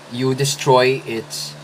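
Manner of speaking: usual speaking voice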